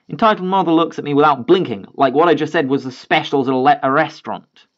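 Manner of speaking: plain reading voice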